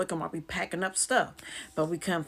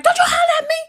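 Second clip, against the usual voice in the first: very high, loud yell; hollering back; 13 dB louder, faster than usual. Retold or imitated. Yelling back at her sister, hollering. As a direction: shriek it back in outrage. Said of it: "I'm hollering back".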